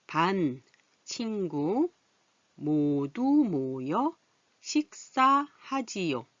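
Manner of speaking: slow, drawn-out reading for learners; slow reading aloud. Shown as a demonstration. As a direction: read each word slowly for the class. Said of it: "어떤 내용을 글을 썼는지 한번 볼까요". (천천히 또박또박 읽기)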